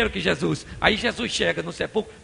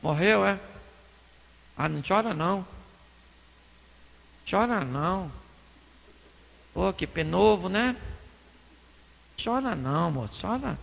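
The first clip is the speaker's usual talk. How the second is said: low, soft, offhand sympathy; hushed pity with little real concern; mock-casual consoling; 3 semitones lower, 5 dB quieter than usual. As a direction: console quietly and half-heartedly. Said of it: "Ele poderia falar assim".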